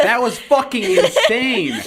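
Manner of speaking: high, loud and slow through laughter; cracking up at the memory; laughing. Real, not acted. Host cracks up recalling the paparazzi guy, laughing loudly.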